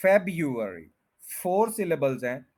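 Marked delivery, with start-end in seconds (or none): 0.1-0.8 February as FEB-yu-er-ee; first-syllable stress. Shown as a demonstration. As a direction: lean on FEB in February, y after the b. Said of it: "first के ऊपर stress है". (February, पहले syllable पर ज़ोर)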